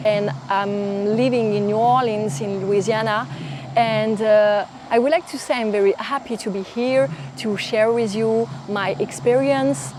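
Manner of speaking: English spoken with a French accent; a French learner speaking English, a bit hesitant; careful English in a French accent. Shown as a demonstration. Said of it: "un petit aperçu de mon accent".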